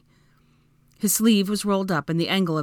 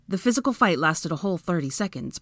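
ordinary delivery

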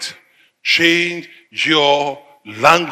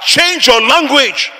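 high, slow preacher's cry; emphatic pulpit shout, drawn out; forceful exhortation; 9 semitones higher, 4 dB louder, slower than usual. Real, not acted. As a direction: drive the command home at a raised pitch. Preacher's climactic command to congregation.

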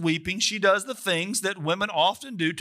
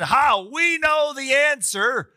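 gloating; 6 semitones higher, 3 dB louder, slower than usual. Retold or imitated. Companions gloat over solved riddle.

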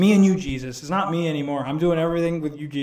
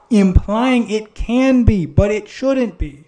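slow, loud, deliberate stress on each word; slowed right down and louder to make the point; slow emphasis; 5 semitones higher, 10 dB louder, much slower than usual. Real, not acted. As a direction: lean hard on every word. Explaining the word must.

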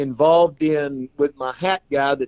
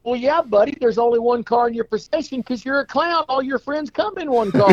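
much higher, matter-of-fact punchline; punchline delivery; 10 semitones higher than usual. Acted. Friend explaining to the clown, matter-of-fact punchline.

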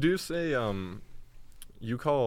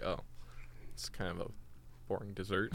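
softer, deflated "oh"; bored, unimpressed; 9 dB quieter than usual. Acted. Student reacting to the dull-sounding pie, unimpressed. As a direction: let the enthusiasm drop away, softly. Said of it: "I'm like".

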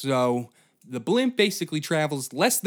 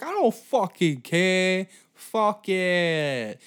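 much higher, louder boastful cartoon voice; cocky fixer; 8 semitones higher, 6 dB louder, much slower than usual. Lou bragging loudly about patching his blimp. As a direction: crow over a lousy patch job. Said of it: "It's like".

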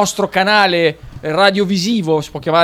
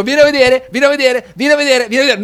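high-pitched insistent nagging; nagging repeat; 6 semitones higher, faster than usual. Retold or imitated. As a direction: mimic someone pestering you. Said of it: "è tutto pomeriggio che ti dico".

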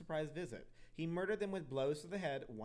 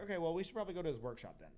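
rushed detectives; 2 semitones higher, much faster than usual. Retold or imitated. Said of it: "they were like".

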